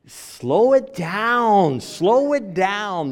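an urgent nurse telling the husband to calm down, stretching each word. Retold or imitated. A nurse warning the hyperventilating husband, slow and urgent. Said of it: "the nurse looks at me and says".

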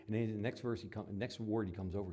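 ordinary talk

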